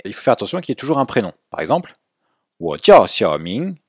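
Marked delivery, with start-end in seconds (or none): 2.6-3.8 wo jiao Xiaoming spoken at half speed, a bit louder; slow model phrase. Shown as a demonstration. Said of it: "ça c'est bon". (wo jiao Xiaoming, lentement et articulé)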